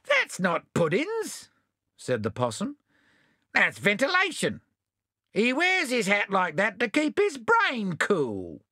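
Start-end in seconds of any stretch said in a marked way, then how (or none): 0.0-1.5 high, slow sly denial; sly possum voice. Acted. Possum denies. 3.5-8.6 sly possum voice. Acted. Possum explains.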